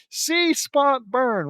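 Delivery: slow, high, loud declaiming; over-the-top emphatic catchphrase; exaggerated battle cry. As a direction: belt out each word separately. Emphatic third try at catchphrase.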